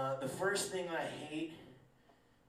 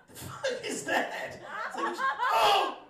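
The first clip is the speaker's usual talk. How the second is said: much higher, slow, pained squeal; shocked yelp; 9 dB louder, slower than usual. Acted. Comedian reacting to a fart-sneeze, pained shocked squeal. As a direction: yelp like something just exploded out of you.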